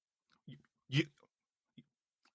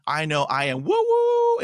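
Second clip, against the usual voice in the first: playful squeal; 14 semitones higher, 4 dB louder than usual. Real, not acted. Playful self-praise, high squeal.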